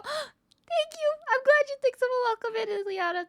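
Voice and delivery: very high, sweet squeal; cutesy thanks. Real, not acted. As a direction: coo it in a tiny high voice. Touched by viewer's compliment.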